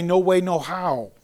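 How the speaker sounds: "No way, no how" said slowly, each word leaned on; emphatic denial. Shown as a demonstration. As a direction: hammer home "No way, no how" as an emphatic no. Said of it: "it's an emphatic".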